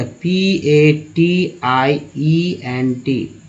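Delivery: slow letter-by-letter spelling of patient; spelling it out slowly. Shown as a demonstration. Teacher spelling the word for students, slow and deliberate. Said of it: "इसका उच्चारण ऐसे करते हैं".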